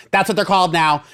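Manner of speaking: sitcom nurse. Retold or imitated. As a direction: pop in like a sitcom neighbour. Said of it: "as though she's in a sitcom".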